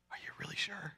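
very soft, unsure question; barely voiced, double-checking before acting; hesitant check.

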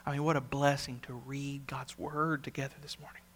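hushed whisper of wonder; a whispered aside about God's word. Real, not acted. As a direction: breathe it almost silently.

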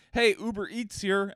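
sudden switch to announcing a delivery; fake delivery driver. Raider suddenly posing as Uber Eats, calling out. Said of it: "And then all of a sudden".

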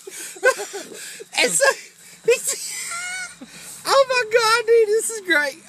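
high, squealing laughter through the words; can't get the words out for laughing; breaking up with high laughter while talking; laughing hard. Real, not acted. Cracking up at the clip.

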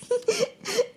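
high little giggle. Acted. Pepe giggles.

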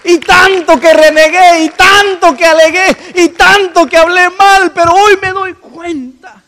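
loud, high wailing cry of remorse. Acted. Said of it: "empieza a llorar y dice".

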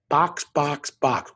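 each box hit loud and clipped; punchy repetition. Real, not acted. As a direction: tick them off one by one with force. Briskly ticking items off.

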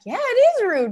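a high, loud, sincere yes; eager agreement. Retold or imitated. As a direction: agree brightly and innocently. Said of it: "I was thinking like".

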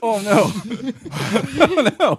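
laughing. Real, not acted. Hiccups from hot jerky, laughing.

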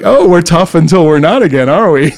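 high, loud mock taunt; mocking tease. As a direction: rib a buddy who lost his nerve. Teasing the buddy who backed down.

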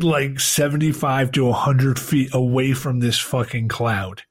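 usual speaking voice

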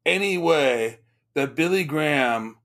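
ordinary delivery

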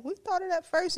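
high-pitched mimicry of a rival; mock copycat voice. Host imagines someone else claiming the hashtag, mock copycat voice.

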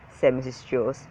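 usual speaking voice

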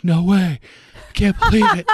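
high, loud reenactment of a thrilled friend; excited disbelief. Retold or imitated. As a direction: exclaim it like an amazed pal on the phone. Friend on phone reacting with disbelief.

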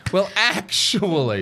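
mock pedant. Correcting her friend's word, a slow smug well actually. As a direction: drag it out like a know-it-all.

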